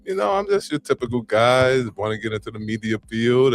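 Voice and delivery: usual speaking voice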